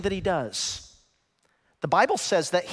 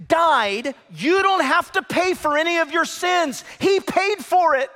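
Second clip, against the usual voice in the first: full-force preaching; 10 semitones higher, 5 dB louder than usual. Acted. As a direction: preach it out to the back row. Preaching climax.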